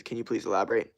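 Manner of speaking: usual speaking voice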